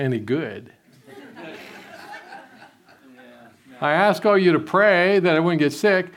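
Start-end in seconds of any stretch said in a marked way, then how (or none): 1.2-2.4 soft, airy chuckle; breathy laugh. Real, not acted. Preacher chuckling at his joke about prayers. 3.8-6.1 louder, stressing ALL, rising end. Real, not acted. Preacher teasing congregation that their prayers failed, loudly.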